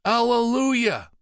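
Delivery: high, loud, drawn-out ecstatic shout; joyful shout. Acted. All the monks together, shouting in joy. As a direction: shout it to the heavens. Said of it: "the brothers shouted as one".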